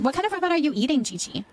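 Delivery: ordinary talk, nothing marked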